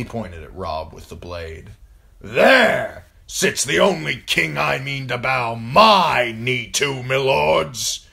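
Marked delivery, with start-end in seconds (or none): 2.2-8.1 loud, high, rousing proclamation; booming warlord's declaration; thundering. A narrator's reading. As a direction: thunder your allegiance to the hall. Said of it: "he thundered".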